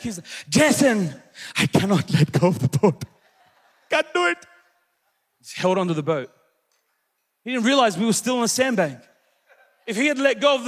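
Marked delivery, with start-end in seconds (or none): 0.5-4.4 desperate dad. Acted. Dad clinging to boat answering his son, acted desperate refusal. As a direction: act out dad's panicked refusal.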